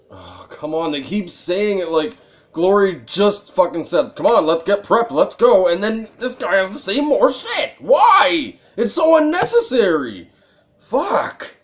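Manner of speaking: loud, high, yelling in frustration at the game; exasperated rant. Real, not acted. Streamer yelling at talkative game characters, furious. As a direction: rant at the endless dialogue, voice cracking high.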